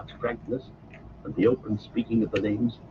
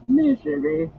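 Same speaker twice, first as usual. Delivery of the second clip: higher, louder rising wail on misery; Gollum wail rising; 6 dB louder, slower than usual. Acted. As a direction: wail misery, pitch climbing at the end. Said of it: "repeated misery".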